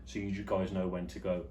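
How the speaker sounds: normal talking voice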